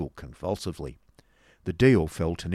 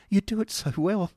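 high, affected tittering jibe; 13 semitones higher, 5 dB louder than usual. Acted. Miss Squeers taunts Nicholas across the table, tittering. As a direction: pipe it up with false sweetness. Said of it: "tittered Miss Squeers".

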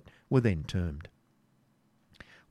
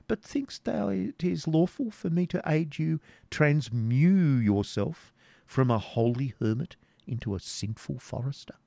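quizzical jester; 7 semitones higher than usual. Acted. Voices the jester Wamba.

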